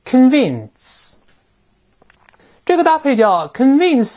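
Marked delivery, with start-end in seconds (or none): 0.0-1.2 "convince" read higher, with a reduced first syllable; teacher's higher, careful "convince" for repeating; model pronunciation. Shown as a demonstration. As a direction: say "convince" as kən-VINCE, stress on the second syllable. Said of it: "所以我们再读一遍".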